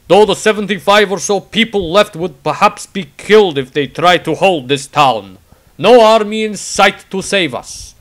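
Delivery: loud, slow warrior with broken English; a blunt fighter booming out his words; Tordrad's voice. Acted. The warrior writing his diary entry, loud and slow.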